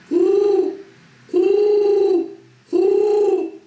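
high, loud hooting; owl hoot. Shown as a demonstration. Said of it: "然后发出两段式的声音".

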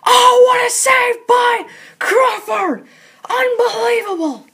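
excited shout. Acted. Big save in hockey game.

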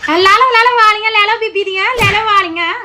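mocking singsong. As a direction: taunt them in a singsong. Mocking others grabbing earrings.

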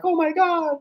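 mock an amazed kid in a high squeal; imitating crazy-excited kids. Kids waking up to Santa's visit, imitated excited squeal. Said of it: "they get up all crazy".

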